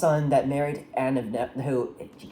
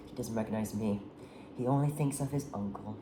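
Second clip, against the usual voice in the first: sigh, then speak softly; quiet acted disappointment; dejected mother; 3 semitones lower, 8 dB quieter than usual. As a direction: let it sink, hushed and resigned. Mother's dejected reply, after a sigh.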